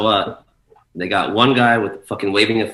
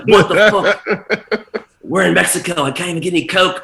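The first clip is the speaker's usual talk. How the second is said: put-on much higher, louder German accent, laughing; mock German accent; 11 semitones higher, 6 dB louder than usual. Strung-out German DJ complaining he can't find coke, mock German accent. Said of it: "I can't do a German accent".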